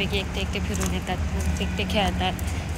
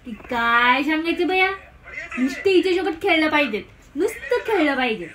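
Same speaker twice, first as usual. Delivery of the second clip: higher-pitched, playful cooing scolding; 5 semitones higher than usual. Real, not acted. Mother recounting her baby's crying and demands, baby talk.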